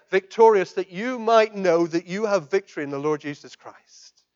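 loud, high, ringing proclamation; full-force preaching. Real, not acted. Preacher proclaiming Jesus' words to the congregation, loud and ringing. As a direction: proclaim it to the back of the church.

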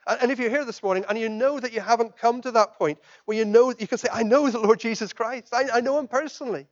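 loud, high, ringing proclamation; full-force preaching. Real, not acted. Preacher proclaiming Jesus' words to the congregation, loud and ringing. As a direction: proclaim it to the back of the church.

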